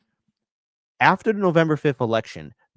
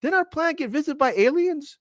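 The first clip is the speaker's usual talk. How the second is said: mock forgetful public; 12 semitones higher than usual.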